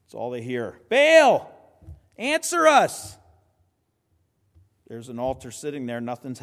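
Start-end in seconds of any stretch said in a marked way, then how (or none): 0.9-3.2 high, loud drawn-out plea; yelling out. Acted. Prophets get no reply from Baal, yelling out. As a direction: yell it up to a god who isn't listening. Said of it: "they start yelling out".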